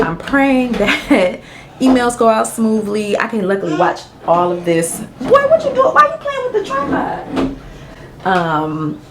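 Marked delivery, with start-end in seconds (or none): 5.2-7.6 calling out to son. Real, not acted. Mom calls to her son, high and loud. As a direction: catch his attention from across the room.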